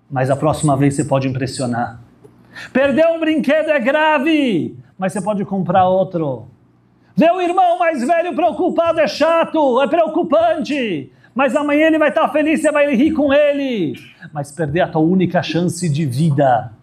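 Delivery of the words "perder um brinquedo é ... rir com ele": emphatic sermon voice; preaching at full force. Acted. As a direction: rise up and call out to the congregation. Preaching contrast, high and slow.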